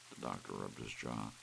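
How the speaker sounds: usual speaking voice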